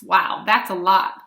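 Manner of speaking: ordinary talk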